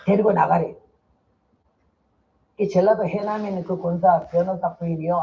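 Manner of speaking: ordinary talk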